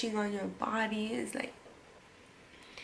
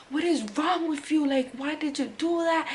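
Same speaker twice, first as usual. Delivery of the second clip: higher, louder mock yell at the man; a partial replay of screaming at him; retold scream; 5 semitones higher, 4 dB louder than usual. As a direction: demand it, outraged. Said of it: "I was, like, screaming at this man".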